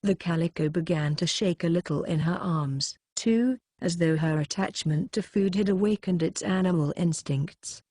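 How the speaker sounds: ordinary delivery, nothing marked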